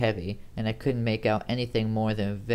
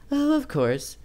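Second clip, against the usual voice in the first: short, curt reply, higher and louder; abrupt; 4 dB louder, slower than usual. Acted. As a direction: cut it off abruptly in Amy's higher voice. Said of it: "she said abruptly".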